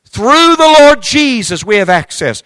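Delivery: high, loud, slow preacher's climax; full-force preaching. Acted. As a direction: stretch every word out like a revival preacher.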